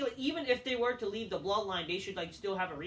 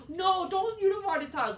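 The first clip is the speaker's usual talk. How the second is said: high, louder mocking whine of the fans; mocking other fans; 9 semitones higher, 6 dB louder than usual. Mocking fans who want separate titles, high whiny voice. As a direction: sneer it like a whiny fan. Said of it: "Some people were like".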